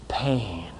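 a soft breath of a word; whispered pain. Real, not acted. Whispered after Thumos means.

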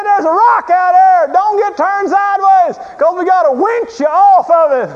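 imitated screaming. Guide warning rafters about the rock, imitated screaming. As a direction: bellow the warning like a frantic guide. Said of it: "He's screaming at us".

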